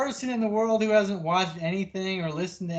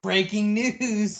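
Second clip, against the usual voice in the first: loud, slow breaking-news call; hammed-up newsflash; mock news announcer; 4 semitones higher, 7 dB louder, slower than usual. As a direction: announce it like a big bulletin.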